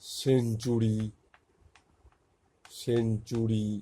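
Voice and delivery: সেঞ্চুরি said carefully and drawn out, opening on an S sound; soft C demonstrated. Shown as a demonstration. Teacher showing learners that C sounds like S in সেঞ্চুরি, careful demonstration. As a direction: model সেঞ্চুরি with a clear initial S, stretching both halves. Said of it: "উচ্চারণটা দন্তস্বর মতো হচ্ছে".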